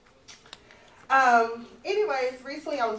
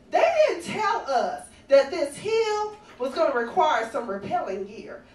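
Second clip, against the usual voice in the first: ordinary talk, nothing marked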